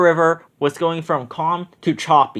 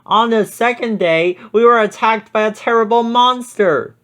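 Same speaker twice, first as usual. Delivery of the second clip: higher, lightly excited telling; excited exclaim; 7 semitones higher, 3 dB louder than usual. A narrator's reading. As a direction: build up the monster part a bit. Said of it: "Ben exclaimed".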